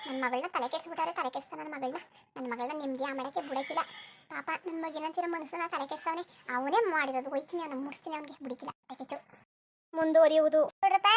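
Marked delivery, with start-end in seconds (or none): 0.1-9.2 muttered threat. Acted. Mother mutters threat to herself.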